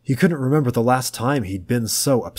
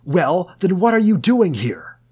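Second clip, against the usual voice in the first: much higher, loud enraged yell; furious scream; 10 semitones higher, 6 dB louder than usual. A narrator's reading. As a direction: scream it at him. Tom screaming at George.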